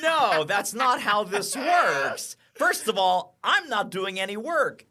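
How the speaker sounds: fired-up retort. Retold or imitated. Coach retelling his reply to a demanding client, fired up. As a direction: set the client straight, loud and deliberate. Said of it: "It's like".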